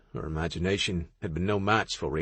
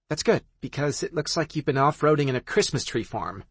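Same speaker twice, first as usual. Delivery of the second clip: teasing friend; 6 semitones higher than usual. Acted.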